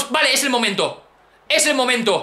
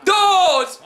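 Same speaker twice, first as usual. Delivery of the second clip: high, drawn-out shouts, falling at the end; triumphant shouting; 7 semitones higher, much slower than usual. Real, not acted. Gamer shouting along with the pin count, triumphant. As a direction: yell the count as if victory is sure.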